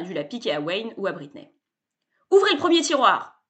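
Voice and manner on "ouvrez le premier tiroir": higher and louder order; commanding salesman. A narrator's reading. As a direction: give the order like a showman. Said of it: "commande Sébastien".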